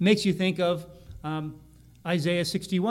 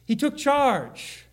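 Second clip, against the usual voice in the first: slow and much higher, drawn out; emphatic; 7 semitones higher, much slower than usual. Acted. Preacher driving home Jesus' authority, slow and higher. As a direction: land it with weight.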